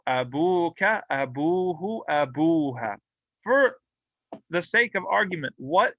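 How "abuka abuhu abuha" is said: Abuka, abuhu, abuha, each bu held long; long waw vowel. Shown as a demonstration. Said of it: "all of these are marfu' with a waw".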